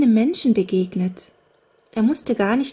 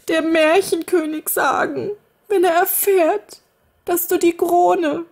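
high, fretful nursemaid voice, lamenting; old nursemaid; 9 semitones higher, 5 dB louder than usual. A narrator's reading. Kinderfrau klagt das Mädchen an, hoch und jammernd. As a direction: wring your hands as you scold.

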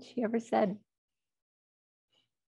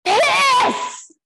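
a huge, much higher, much louder bellow; 12 dB louder than usual. Retold or imitated. Dying mother demanding the toilet, bellowing. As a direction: bellow it out as clear as a bell. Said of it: "And then she bellowed".

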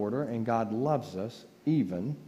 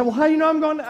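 much higher, louder squeaky Carol; mock Carol; 8 dB louder, much faster than usual. Quoting Carol questioning heaven, a high squeaky mock voice.

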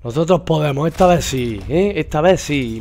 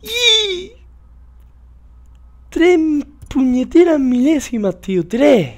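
frustrated outburst; 12 semitones higher, much slower than usual. Real, not acted. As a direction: yell at losing by a hair. Losing by milliseconds, outburst. (grito de rabia por tres milésimas)

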